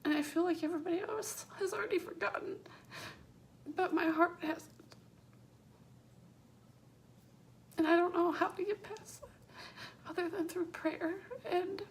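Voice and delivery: speaking through real tears; crying. Real, not acted. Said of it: "Sorry for ugly crying".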